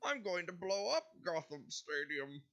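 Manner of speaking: high muffled Bane impression. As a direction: do Bane's muffled villain voice. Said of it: "that's the character voice right there".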